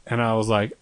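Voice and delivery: ordinary delivery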